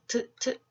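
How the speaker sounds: 트 as a short aspirated T sound, twice; short, separated 트 beats; letter sound demo. Shown as a demonstration. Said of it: "어떤 발음이 나요?".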